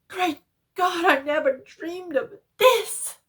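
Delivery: high, loud, breaking into a cry; despairing shout from below; hoarse with fear and despair. A narrator's reading. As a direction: stammer then wail in horror. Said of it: "still hoarse with fear, and now apparently tinged with despair".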